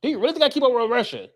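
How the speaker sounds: high-pitched comic protest; mock indignation. Answering a question about Russians, mock indignation. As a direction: push it high in exaggerated exasperation.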